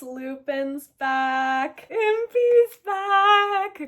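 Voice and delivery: loud, high, stretched-out cheer; excited roll call. Real, not acted. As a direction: draw each announcement out in celebration. Celebrates the favorites' return.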